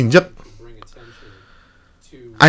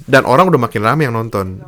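ordinary talk, nothing marked

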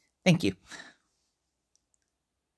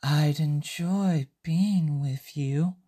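very slow, drawn out, rising on "being"; slow misheard line; 3 semitones lower, 3 dB quieter, much slower than usual. Acted. Usuke hearing the teller's words slowly. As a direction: stretch "I'd enjoy being with you." slowly like a dreamy mishearing. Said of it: "This is what Usuke heard".

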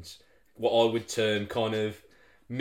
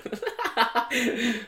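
high-pitched burst of laughter; real laugh; 13 semitones higher than usual. Real, not acted. Co-hosts moving on to war film lists, a high real laugh. Laughing after naming his favourite.

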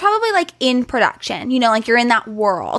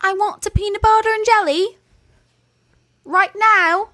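much higher, mock posh British kid demanding; bad British accent; 10 semitones higher than usual. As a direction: play a spoiled royal child in a hammy British accent. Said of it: "Our accents are garbage".

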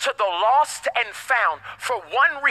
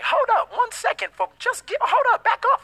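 much higher, playful hold-it interjection; high-pitched interruption; 12 semitones higher than usual. Acted. Preacher halts judgmental churchgoers, high-pitched interruption. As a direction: cut in high to halt the objectors. Halting imagined objectors mid-quote.

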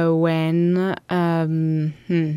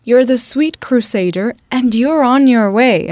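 higher and louder, belting the words out; powerful voice; 4 semitones higher, 6 dB louder than usual. Retold or imitated. Retelling the singer's powerful refrain, loud and lifted. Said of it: "sings again with this very powerful voice".

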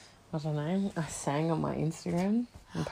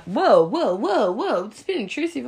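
alarmed guys; 7 semitones higher, 13 dB louder than usual. Retold or imitated. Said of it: "they're like".

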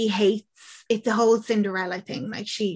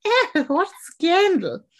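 high, drawn-out exaggerated outrage; mock scandalised; 8 semitones higher, 4 dB louder, slower than usual. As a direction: play up the shock, slow and high. Acts out girl feigning scandalised shock.

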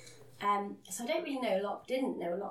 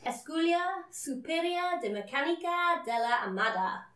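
careful, higher and louder go at Spanish; Spanish accent, raised and emphatic; attempted Spanish accent; 8 semitones higher, 6 dB louder than usual. Shown as a demonstration. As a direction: have a try at Spanish pronunciation, projecting it. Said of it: "try and say this in Spanish".

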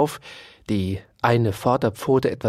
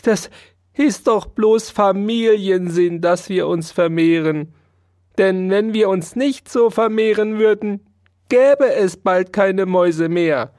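earnest mouse; 7 semitones higher, 4 dB louder than usual. Acted. Mouse defends her family.

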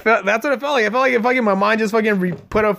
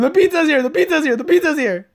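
high, loud gleeful calling; excited announcing; 11 semitones higher, 3 dB louder than usual. Retold or imitated. Kid telling every room the pizza came, high gleeful calls. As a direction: run through the house calling it out.

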